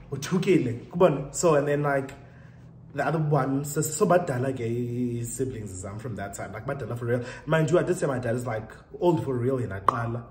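normal talking voice

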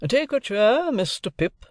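high, commanding guardian; guardian's voice. A narrator's reading. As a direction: offer it as a lofty guardian.

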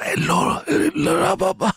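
put-on dying old man. Teacher speaking ancient Greek, like a dying old man. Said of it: "sounded like a dying old man".

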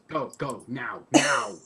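rising to a loud shout on the last word; acted urgent order, building in force; urgent command. Player voices Green urging escape.

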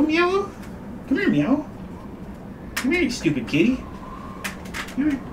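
high sing-song calls to a cat; pet talk. Real, not acted. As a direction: coax the kitty over. Calling the cat.

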